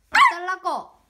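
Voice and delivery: loud whiny dog voice; loud demand. Pretend. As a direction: yelp it as the hungry dog. (강아지 대신 크게 조르듯)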